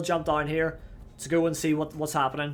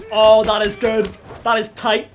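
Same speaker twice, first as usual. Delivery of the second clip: loud, raised-pitch delight building to high shouts; excited approval; 4 semitones higher, 10 dB louder than usual. Real, not acted. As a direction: cheer it, climbing on each repeat. Delighted by score progress.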